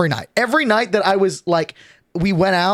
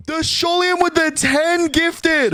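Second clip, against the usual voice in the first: excited; 11 semitones higher, slower than usual. Real, not acted. Reading a big gift alert.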